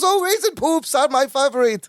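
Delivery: high-pitched accented character imitation; Balki. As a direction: put on his high, gushing voice. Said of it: "And Bucky goes".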